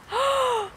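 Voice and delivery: sharp delighted gasp; excited gasp. Real, not acted. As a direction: gasp at the reveal. Big reveal of waffle.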